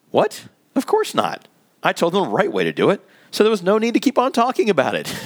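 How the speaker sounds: high, incredulous "What?" then a dismissive brush-off; indignant surprise. Acted. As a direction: sound shocked anyone would question you. [name] indignant at Tom's question.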